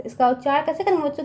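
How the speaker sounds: ordinary talk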